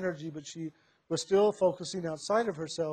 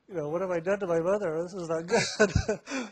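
laughing through a retold panicked thought; amused mock dismay. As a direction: chuckle while recalling your own horror. Aghast thought retold amid laughter.

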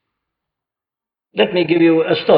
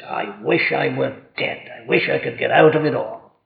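low, much softer, weary; despairing sigh; 4 semitones lower, 5 dB quieter than usual. Retold or imitated. Preacher voicing troubled people, low and soft despair. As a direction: let it sink as if giving up. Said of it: "They say".

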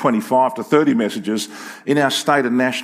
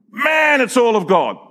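high, loud burst of discovery; punchy, high-pitched realization; emphatic revelation; 9 semitones higher, 4 dB louder than usual. Acted. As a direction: exclaim it as if just struck by it. Acts out reaction to reading scripture.

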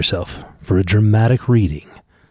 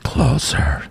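whisper; 3 semitones higher than usual. Real, not acted. Dad whispering instructions.

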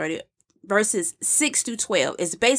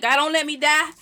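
higher-pitched mock plea to God; acted pleading; 7 semitones higher than usual. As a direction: plead like someone bargaining for life. Voicing David pleading for his life.

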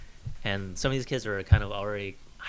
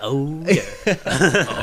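leering; 3 semitones higher, 7 dB louder than usual. Retold or imitated. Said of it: "Steve was like".

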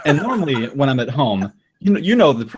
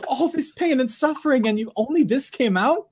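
disbelief; 12 semitones higher than usual. Retold or imitated. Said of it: "I'm like".

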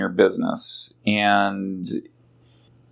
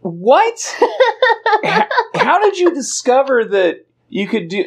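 much higher, laughing disbelief; incredulous; 13 semitones higher, 4 dB louder than usual. Real, not acted. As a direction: gasp out the question in disbelief.

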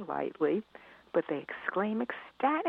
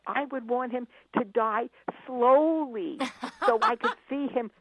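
put-on gleeful little boy; 6 semitones higher, 5 dB louder than usual. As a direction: relish every word like a sweet menace. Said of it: "And he said".

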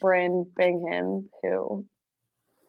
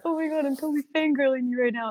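quick, high fan gush; imitated fangirling; 5 semitones higher, faster than usual. Fans meeting a film rider, imitated in a quick, high gush. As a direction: lightly mimic a fan's rushed excitement. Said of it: "people were a little bit like".

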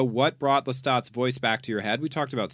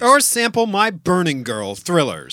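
upbeat ad read; promo read; 2 semitones higher, 4 dB louder than usual. Acted. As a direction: sell the show brightly.

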